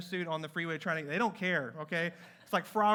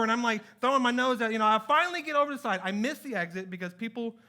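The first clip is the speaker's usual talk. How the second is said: ordinary delivery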